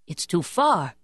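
ordinary delivery, nothing marked

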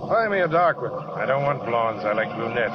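sleep talking. Acted. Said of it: "you were sleeping and you talked".

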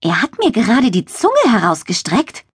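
high-pitched, indignant little spider. A narrator's reading. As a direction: complain in a small outraged voice. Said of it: "empörte sich Linne". (empörtes, hohes Stimmchen)

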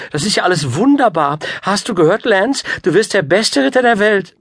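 high, loud gushing; delighted queen. A narrator's reading. Queen telling the boy his glorious future, delighted.

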